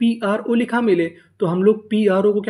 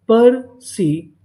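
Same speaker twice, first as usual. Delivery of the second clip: पर and सी stretched out, pause between; syllable by syllable; 3 semitones higher, 5 dB louder, much slower than usual. Shown as a demonstration. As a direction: sound out पर, then सी, very slowly and louder. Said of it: "तो हमारी meaning क्या बनी". (पर और सी को अलग-अलग, खींचकर)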